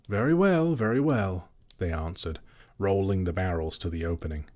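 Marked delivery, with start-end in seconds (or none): 0.1-1.5 mock agreement. Elves humouring the butler.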